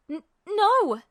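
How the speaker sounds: high, loud stammered no; panicked gasp. A narrator's reading. Captive Ed cutting off the villain, panicked stammer. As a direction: blurt it out in panic. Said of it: "Ed gasped".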